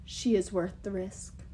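slow, softened giant-king leer. A narrator's reading. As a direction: say it slowly, savouring the bride. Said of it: "Thrym leered at Alex".

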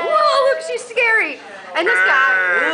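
excited surprise. Real, not acted. Sees scary makeup, exclaims.

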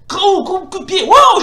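high, loud burst of excitement at the combo; excited shout. Real, not acted. Gamer cheering his fighter's kick, excited high shout.

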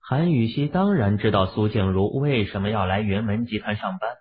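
ordinary delivery

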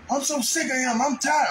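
mimicking her complaint. Wife overheard complaining on phone.

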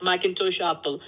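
ordinary talk

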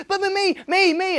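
very high, loud whiny me-me mimic; mock a needy attendee begging for attention. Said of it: "people are like".

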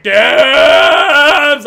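excited shout. Retold or imitated. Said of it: "You're just like".

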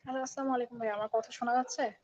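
faint, far from the mic; barely audible greeting; too quiet. Real, not acted. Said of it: "একটু জোরে বলতে হবে ভাই।".

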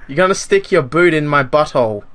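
usual speaking voice

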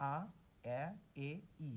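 আ, এ, ই one by one, lips spreading wider; vowel series demo. Shown as a demonstration. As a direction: separate each vowel, widening the lips from আ to ই. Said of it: "অর্থাৎ চওড়া হচ্ছে". (ঠোঁট চওড়া করে আলাদা আলাদা স্বরধ্বনি)

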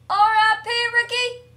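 high, loud mock-mourning yell; hammed-up high scream; screamed farewell. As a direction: shriek it out, ending in a long scream. Screaming as players die.

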